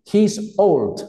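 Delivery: put-on teacher presenting "He's old" word by word; model pronunciation. Shown as a demonstration. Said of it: "Pronúnciala, Manuel".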